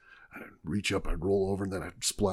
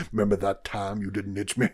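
mock Southern drawl; 8 dB louder than usual. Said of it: "your face has Southern accents".

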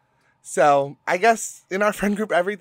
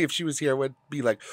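normal talking voice

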